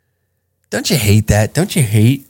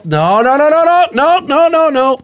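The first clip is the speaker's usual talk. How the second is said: much higher, rising frantic shout. Acted. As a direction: shut her down in a panic. Cutting off imagined partner.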